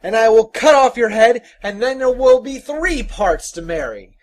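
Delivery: loud, high threat; bold, ringing challenge; defiant cry. Acted. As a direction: threaten the demon boldly. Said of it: "he cried".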